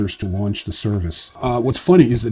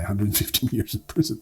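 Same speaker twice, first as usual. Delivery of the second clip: softer, with a disbelieving laugh through it; laughing aside; 7 dB quieter than usual. Real, not acted. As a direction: chuckle at the absurd number. Laughs at sentence length.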